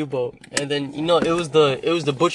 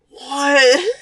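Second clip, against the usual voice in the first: high, drawn-out disbelieving what; baffled what; 11 semitones higher, 6 dB louder than usual. Retold or imitated. Customer stunned by the flat denial, high disbelief. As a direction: sound totally baffled.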